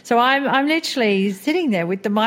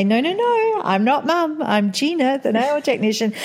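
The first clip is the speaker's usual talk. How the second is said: much higher, insistent salon-worker persona; playing Gina the technician; 7 semitones higher than usual. Retold daughter-playing mother insisting she is a nail technician, higher put-on persona. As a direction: mum playing a pretend salon worker, firmly in character. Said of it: "she would speak in a different accent".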